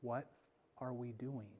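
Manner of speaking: soft, low question; hushed question to the whole church; quiet voice. Acted. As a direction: ask it gently under the breath.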